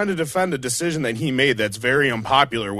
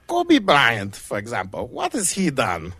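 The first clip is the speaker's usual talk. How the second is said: put-on hammy German accent, punching the name; slower than usual. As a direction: do a cartoon German coach's accent. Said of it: "my best, uh, stereotypical German accent".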